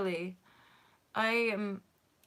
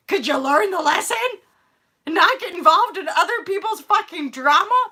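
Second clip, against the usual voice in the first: higher and louder exasperated scold; loud self-scolding; 9 semitones higher, 10 dB louder than usual. Retold or imitated. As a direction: shout the lesson at herself. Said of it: "So I'm like".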